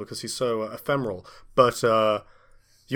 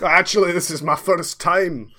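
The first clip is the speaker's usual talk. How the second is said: put-on Scottish accent; 5 semitones higher than usual. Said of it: "Wallace Agentman accent".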